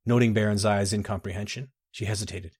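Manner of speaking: ordinary delivery, nothing marked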